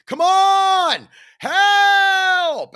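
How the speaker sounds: high, loud, desperate imitated plea; mock terrified yell; panicked cry for help. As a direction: cry out like a man adrift. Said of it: "I hear Art just going".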